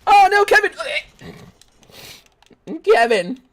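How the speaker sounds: high, loud cry of alarm; panicked shout. Real, not acted. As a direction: yell the teammate's name in panic. Teammate dies in game.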